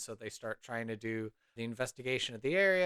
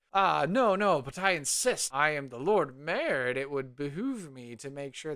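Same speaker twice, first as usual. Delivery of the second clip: loud, higher-pitched self-important insisting; insistent pompous mayor; 3 semitones higher, 5 dB louder than usual. Acted. Mayor pressing his luck with a maiden, pompous and insistent. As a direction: puff up and override the refusal. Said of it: "he still presses his luck".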